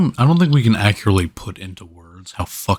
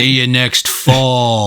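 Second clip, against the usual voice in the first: higher, slow, drawn-out taunt; cheesy one-liner; 4 semitones higher, 4 dB louder, much slower than usual. Acted. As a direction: stretch it like a hammy action hero. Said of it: "if you're gonna, like, throw someone".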